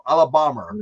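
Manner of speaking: mock an American saying Alabama as "Ala-BAH-ma"; bad American accent. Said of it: "an Englishman trying to do an American accent badly".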